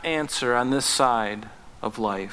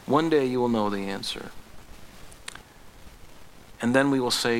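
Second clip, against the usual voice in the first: usual speaking voice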